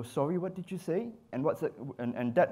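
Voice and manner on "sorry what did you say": higher, lightly voiced confused model; puzzled model. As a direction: ask as a model who didn't catch it. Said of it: "She's gonna go".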